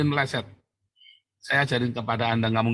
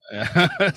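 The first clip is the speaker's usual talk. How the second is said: short amused laugh; mocking laugh; 5 semitones higher than usual. Real, not acted. Preacher scoffing at absent challengers, mocking laugh.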